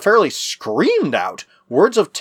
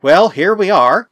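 higher, louder, drawn-out exclamation; exclaimed; 5 semitones higher, 6 dB louder, slower than usual. A narrator's reading. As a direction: announce it with raised voice, slowly. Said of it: "exclaimed Jack".

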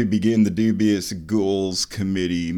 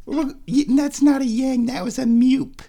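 high, slightly louder pet voice; cat talk; 13 semitones higher, 4 dB louder than usual. Real, not acted. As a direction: tease the cat about its meow. Correcting the cat's meow.

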